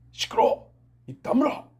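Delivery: sharp loud command to hush; Jesus rebuking the storm, shouted high; stern rebuke. Acted. Jesus shouting at the sea to be silent. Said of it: "예수님이 이렇게".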